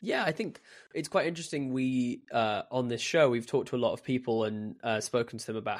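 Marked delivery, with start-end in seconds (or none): none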